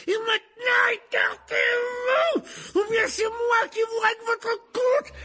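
loud rant building to shrieking high screams; hysterical rage. Acted. Teacher rages at boy blocking him, screaming. Rogue's acted mad rage at Harry.

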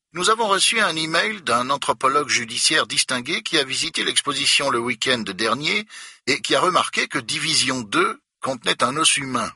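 stiff, irritated official explaining; annoyed museum director. A narrator's reading. Director tells Becker of a problem, prim and annoyed.

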